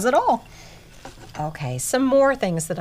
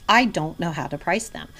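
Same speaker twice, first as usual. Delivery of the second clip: normal talking voice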